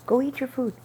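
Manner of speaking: loud firm order to a pet; firm command to dog. Real, not acted. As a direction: shoo the dog off firmly. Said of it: "shooing dog away, firm".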